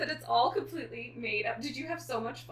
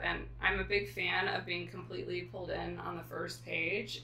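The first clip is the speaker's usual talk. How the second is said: ordinary talk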